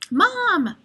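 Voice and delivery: excited high call of Mom; yell. A narrator's reading. Boy spotting mom in doorway, happy yell. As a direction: shout her name with joy. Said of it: "I yell".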